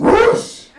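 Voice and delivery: loud, high imitated whoosh; sudden burst of rushing-air noise; whoosh sound. Imitates girl being thrown in film.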